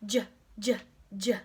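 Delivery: J, j, j bounced like jumps; bouncy J sound. Shown as a demonstration. Teacher jumping with students, bouncy J sound. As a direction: pop each j as if jumping. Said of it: "like you're jumping on a trampoline".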